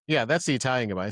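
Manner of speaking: usual speaking voice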